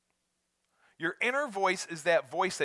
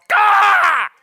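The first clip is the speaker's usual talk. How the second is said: loud high cry of frustration; frustrated yell; 11 semitones higher, 14 dB louder than usual. Acted. Driver finding an empty tank, a loud frustrated yell. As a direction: groan out your annoyance at yourself.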